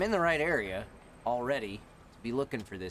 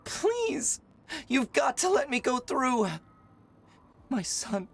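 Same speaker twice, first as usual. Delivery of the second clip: frantic mother; 12 semitones higher, 4 dB louder, slower than usual. Pretend. Voicing frantic mother NPC.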